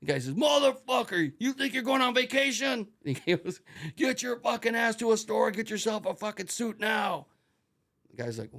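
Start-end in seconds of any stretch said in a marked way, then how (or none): 0.3-2.9 high, loud outraged shout; explosive shouted challenge; drill instructor yell. Retold or imitated. Instructor berating badly dressed recruit. 3.9-7.3 loud, high angry order; bellowed command, dragging out the end; drill instructor yell. Retold or imitated. Instructor ordering recruit to buy suit.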